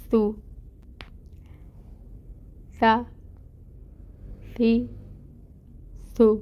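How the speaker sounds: each syllable with a soft s and no whistle; isolated Arabic letter drill; soft tajweed drill. Shown as a demonstration. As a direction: drill the syllables gently, no hiss. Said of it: "اس کی practice کرتے ہیں".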